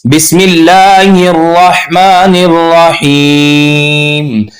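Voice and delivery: slow, melodic Quran recitation; correct recitation. Shown as a demonstration. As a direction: recite it right, slowly. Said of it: "ये गलत है". (सही तिलावत)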